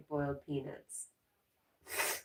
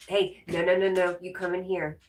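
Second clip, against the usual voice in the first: 1 calling out to a dog; 2 semitones higher, 7 dB louder than usual. Real, not acted. Calling the dog Jack back.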